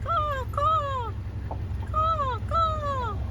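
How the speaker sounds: high cawing like a crow; playful high crow calls; crow caw imitation. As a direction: call out to the crows in their own voice. Calling to crows in graveyard.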